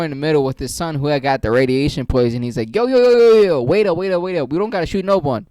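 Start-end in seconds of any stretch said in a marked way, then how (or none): 2.8-5.5 higher, louder panicked plea; urgent yell. Retold or imitated. Friend yelling to stop the robbery, loud and urgent. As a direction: shout to stop the gun.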